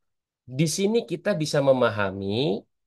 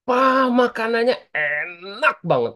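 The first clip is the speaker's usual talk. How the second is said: put-on slow, higher kid's exclamation about food; mock child delight, higher and slowed; loud child remark; 9 semitones higher, 4 dB louder, much slower than usual. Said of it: "ngomongnya kencang lagi".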